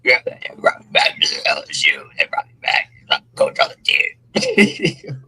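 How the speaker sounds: low, husky coach impression. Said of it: "Why he sound like that, bro?".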